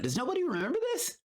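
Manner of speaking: high fast outburst to the viewers; exasperated, rushed appeal; incredulous. Real, not acted. Asking viewers why nobody remembers, high incredulous outburst.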